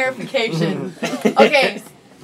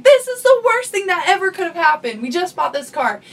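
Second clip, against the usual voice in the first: mild mock scream; 6 semitones higher than usual. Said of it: "She was screaming".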